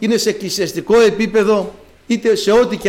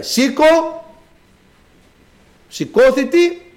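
calling out; 8 semitones higher, much slower than usual. Acted. Voice of the Lord calling.

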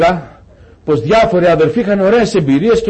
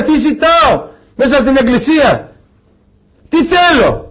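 raised, searching pulpit questions, one after another; fervent preaching; 5 semitones higher than usual. Acted. Preacher challenging the brothers, raised and fervent. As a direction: press the congregation with each question.

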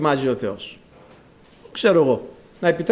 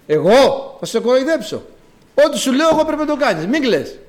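raised, louder authoritative reply; acted firm command; 7 semitones higher, 4 dB louder than usual. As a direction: insist as one who must be obeyed. Acts the Lord's firm reply. (επιτακτική απάντηση)

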